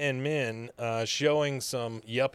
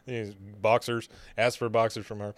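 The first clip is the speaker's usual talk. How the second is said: ordinary delivery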